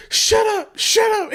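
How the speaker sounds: very high, mock-frantic shout; panicked hushing. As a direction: imitate a desperate friend shutting you up. Panicked buddy begs him to stop.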